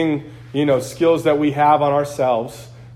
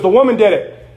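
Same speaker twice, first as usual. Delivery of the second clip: higher, louder excuse as Adam; mock blame-shifting; 4 semitones higher, 6 dB louder than usual. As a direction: pass the blame in an acted protest.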